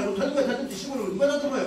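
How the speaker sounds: retorting in a quarrel. Retold or imitated. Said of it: "이렇게 싸웠단 말이에요".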